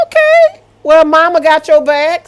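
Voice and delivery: high, loud whoop of approval; excited cheer. Real, not acted. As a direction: cheer the mom on from the sidelines. Cheering the mom's comeback.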